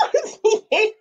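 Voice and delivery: high squealing delight with laughter; gushing. Real, not acted. Fan gushing to viewers over a beloved couple, squealing. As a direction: squeal it, overcome by cuteness.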